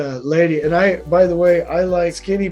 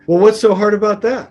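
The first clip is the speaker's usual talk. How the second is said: raised, higher, mildly indignant challenge; retold yelling; 5 semitones higher, 6 dB louder than usual. Intimidating lady confronts superintendent over a bad grade, mock yelling. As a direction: imitate an irate client pushing back, louder. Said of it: "to yell at me about this".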